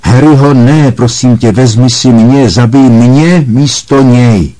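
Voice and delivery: high, loud terrified begging; a mother's frantic plea to spare her son; desperate pleading. Acted. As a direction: beg for his life in terror. Mother begs for son's life.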